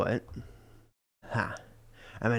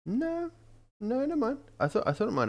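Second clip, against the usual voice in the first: surprised. Real, not acted. Reacting to screen.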